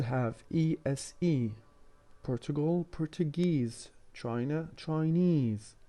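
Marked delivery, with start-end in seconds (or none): 2.9-3.9 "Portuguese" stressed on GUESE; stress on the suffix. Shown as a demonstration. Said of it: "The stress is on this suffix". 4.8-5.7 "Chinese" with a high, long stressed NESE; stress on the suffix. Shown as a demonstration. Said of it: "The stress is on this suffix".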